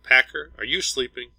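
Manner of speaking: lightly acted call to rouse a crewmate. A narrator's reading. Crewmate calls sleeper over comm.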